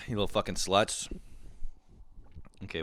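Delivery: ordinary talk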